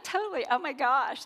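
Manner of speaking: high mock Valley girl gush; hammed-up Valley girl; Valley girl talk. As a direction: squeal it like a teen Valley girl. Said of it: "doing Valley Girl talk".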